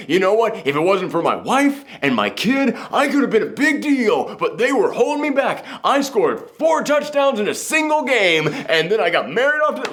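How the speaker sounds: high, boastful has-been rant; washed-up ex-jock. Retold or imitated. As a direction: brag like a bitter former football star. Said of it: "I'm referencing Married with Children".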